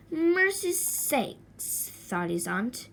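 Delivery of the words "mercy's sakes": very slow, high murmured exclamation. Acted. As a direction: marvel at the sleeping boy. Aunt sees boy asleep.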